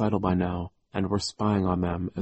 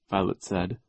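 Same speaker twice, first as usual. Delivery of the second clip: plain reading voice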